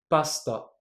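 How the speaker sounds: pasta with the u dropped, only the s heard; devoiced vowel. Shown as a demonstration. Teacher shows the silent vowel in pasta, s kept bare. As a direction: say pasta letting the u vanish after the s. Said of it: "Écoutez bien".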